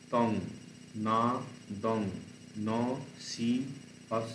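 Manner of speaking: each syllable said apart with a pause. Shown as a demonstration. Teacher splitting the verse line to count its eight syllables. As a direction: count the syllables out one at a time. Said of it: "আটখানা syllable আছে".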